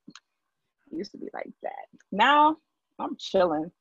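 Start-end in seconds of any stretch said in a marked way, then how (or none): 0.1-1.9 whispered repeat. Shown as a demonstration. Repeating her old short patience in a slow whisper.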